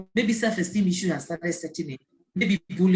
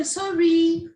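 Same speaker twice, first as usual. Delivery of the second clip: put-on child saying sorry; 9 semitones higher, 6 dB louder than usual. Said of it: "She will say".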